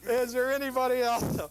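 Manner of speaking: high, loud echo of the punchline; mock punchline yell. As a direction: repeat the yell for laughs. Repeats the punchline.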